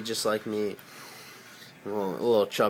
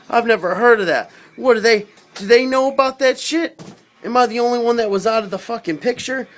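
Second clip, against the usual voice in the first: high, loud bewildered rant; frantic disbelief; 10 semitones higher, 7 dB louder than usual. Retold or imitated. Baffled by the commander's star child claim, high frantic rant. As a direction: spiral into loud confused panic.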